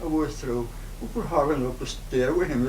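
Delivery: normal talking voice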